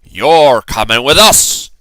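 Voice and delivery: loud slow pirate command; pirate order. A narrator's reading. Braid Beard telling the boy he comes along, loud command. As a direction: tell him like a captain. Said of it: "Braid Beard told me".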